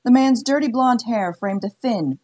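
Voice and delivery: plain reading voice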